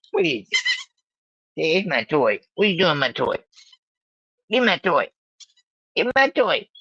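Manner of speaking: high squeaky voice playing the dog; high pretend puppy voice begging for its toy; baby voice for the dog. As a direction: voice the dog's whiny demand, high and childish.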